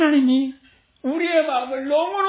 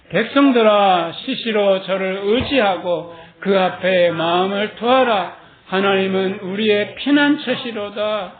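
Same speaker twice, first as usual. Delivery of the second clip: slow, louder recited verse; solemn scripture reading; 3 semitones lower, 4 dB louder than usual. Acted. Preacher reading Psalm 62 to the congregation, slow and solemn. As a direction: proclaim the psalm slowly to the congregation.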